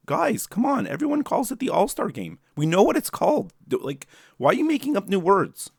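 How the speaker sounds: high-pitched, exasperated rant; mock disbelief, pleading with the league. Real, not acted. Ranting about the silly name.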